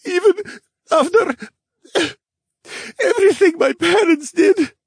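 put-on very high, loud sobbing child; crying bear cub, words broken up; tearful, halting. A narrator's reading. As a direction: choke out the question between long pauses. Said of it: "in a voice I could barely hear".

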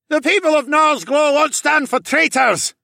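high, loud, strained shout; heckler calling out. A narrator's reading. Angry townsman to the hero, calling out. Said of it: "a voice called out from the crowd".

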